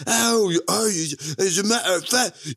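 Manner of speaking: high, hammed-up Welsh accent; exaggerated Welsh accent rant. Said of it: "doing his super insane Welsh accent".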